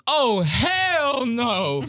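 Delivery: high, slow, drawn-out yell of disgust; mock shocked yell. Imitating Q's disgusted shout at the reveal. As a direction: stretch it out like you can't believe it. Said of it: "Q goes".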